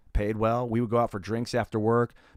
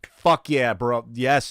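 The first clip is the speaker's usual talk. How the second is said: real cheer, higher and louder; excited cheer; 3 semitones higher, 8 dB louder, much slower than usual. Real, not acted. Thrilled streamer thanking Austin Ward for subscribing, loud and high. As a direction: celebrate the sub out loud.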